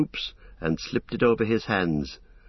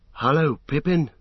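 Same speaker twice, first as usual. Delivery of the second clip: higher, lightly cheeky hobbit voice; Merry; 6 semitones higher than usual. Acted. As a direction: greet a friend with wry cheer. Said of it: "he said".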